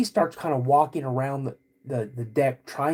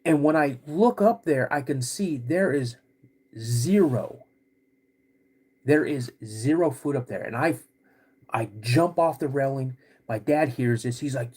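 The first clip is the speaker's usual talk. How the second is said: ordinary delivery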